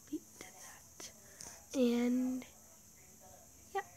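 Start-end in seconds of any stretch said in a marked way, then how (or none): 0.1-1.1 whispering. Real, not acted. Whispered note to self.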